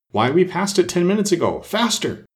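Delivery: high-pitched, hurrying queen; urgent urging. A narrator's reading. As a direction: urge her on in a high voice. Queen urging Alice to run.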